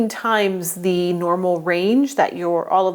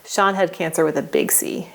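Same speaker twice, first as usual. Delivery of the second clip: ordinary delivery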